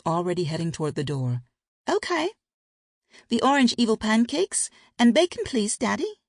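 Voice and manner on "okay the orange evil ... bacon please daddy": put-on high, bright-eyed child voice asking sweetly; a small kid saying "evil pancakes" in a high chirp; little girl. Daughter asks Daddy for pancakes, high childlike.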